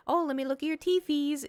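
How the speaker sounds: put-on high, coaxing baby-talk voice; pet baby talk. Imagined baby talk to cat.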